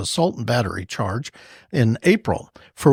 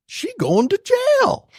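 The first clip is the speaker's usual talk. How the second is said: mock sing-song.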